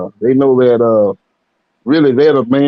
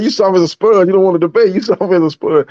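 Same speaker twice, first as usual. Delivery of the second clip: higher teasing jab; mock taunt; 6 semitones higher than usual. Guest retelling an insult to Rick, mock taunt. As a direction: needle him like a schoolyard taunt. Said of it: "He said".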